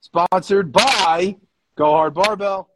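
loud, slow, drawn-out sponsor read; big stretched-out announcer voice; announcer sign-off. Real, not acted.

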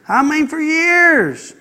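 slow high drawn-out for years; for years, lifted high and drawn slow; stress on years. Real, not acted. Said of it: "preacher stressing the length".

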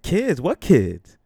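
loud, high burst of disbelief; incredulous demand. Retold or imitated. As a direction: fire the question back, astonished. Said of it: "I said it like that".

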